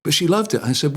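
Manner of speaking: ordinary talk, nothing marked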